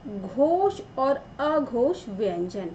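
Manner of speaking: slow, deliberate reading of the chapter title; slow heading read. Shown as a demonstration. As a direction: read the title out word by word, slowly. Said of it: "क्या पढ़ेंगे हम?".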